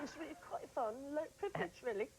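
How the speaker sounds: put-on high, polite British accent; mock British accent. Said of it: "British people are so distinguished".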